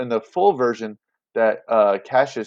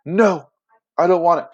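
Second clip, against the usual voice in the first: higher and louder mock refusal; 6 semitones higher, 5 dB louder than usual. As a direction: push the crown away with a firm no. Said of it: "And he goes".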